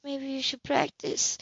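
playful tease. A narrator's reading. Playful invitation, hand held out.

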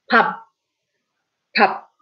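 Pap with an unreleased final P; Pap, short and clipped; Korean pap. Shown as a demonstration. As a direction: say Pap, lips closing on the end. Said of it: "Pap is, literally means rice".

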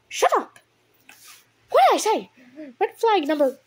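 high, loud furious shout; outraged yell. Real, not acted. Reader shouts at the cat over another red flag. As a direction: yell it in disbelief.

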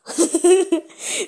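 high, loud giggle; laughing. Real, not acted. Vlogger cracking up at own Spanish lines, high giggling. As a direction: burst into a high-pitched laugh.

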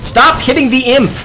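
frustrated shout at himself for hitting the imp; exasperated yell. Real, not acted. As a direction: yell at your own hands. Scolding himself mid-fight.

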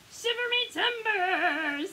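high, loud, drawn-out pirate wail; little pirates. A narrator's reading. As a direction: stretch it out like a shocked pirate crew. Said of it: "say her little pirates".

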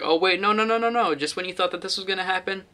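light mock gasp at a plot twist, no real shock; mock surprise. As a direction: pitch jumps up on the no's, then settles. Acting out a film's twist.

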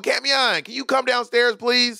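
high, sing-out call for someone upstairs; calling downstairs politely. Shown as a demonstration. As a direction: demonstrate a polite call through the house. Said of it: "I'll go".

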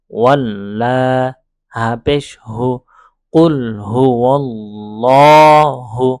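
slow letter-by-letter spelling, joined syllables drawn out; spelling recitation. Shown as a demonstration. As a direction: spell each syllable, then join them slowly. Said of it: "এইখানে আমরা বানান".

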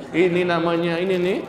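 ordinary talk

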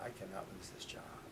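low, whispered inner thought; hushed worry. Retold or imitated. Worried man thinks about being fired, hushed and low. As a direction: say it under your breath, to yourself.

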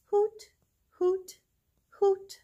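high hooting owl; high mock owl hoots. Owl sounds in farm book.

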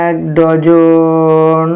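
very slow, syllables held long and level; long drawn-out write-along; dictating while writing. Real, not acted. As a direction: stretch the words to match the pen. Writing after asking what to write.

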